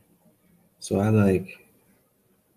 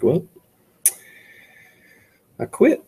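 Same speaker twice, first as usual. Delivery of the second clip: high, loud pretend resignation; mock giving up; 7 semitones higher, 3 dB louder than usual. Imagining answering a student, mock quitting loudly and high. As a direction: throw up your hands in a hammed-up "I quit". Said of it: "And I'll be like".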